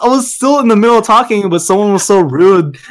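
high, huffy, indignant; mock offended. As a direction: play the wounded speaker.

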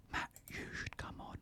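soft breathy whisper; ASMR whisper. Acted. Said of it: "I'm trying to do some A S M R for you".

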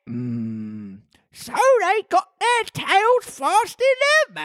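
very high, slowish creature voice; squeaky storybook beast; Griffin. A narrator's reading. Reads Griffin's explanation.

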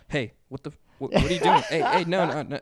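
high, loud hammed-up cry through laughter; mock panicked protest. Ex sabotaging the interview, laughing mock cry. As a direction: fake being groped, laughing as you shout. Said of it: "immediate sabotage".

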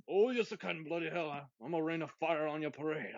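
playful Scottish brogue, half-joking; mock Scottish accent. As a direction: ham up a boastful Scottish action hero. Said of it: "the accents would be all over the place".

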